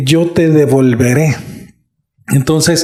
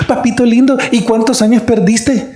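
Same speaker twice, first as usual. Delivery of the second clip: put-on high-pitched voice of a dear old lady; put-on high granny voice; elderly lady; 7 semitones higher than usual. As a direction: imitate a very old woman's sweet questioning. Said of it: "una señora muy querida".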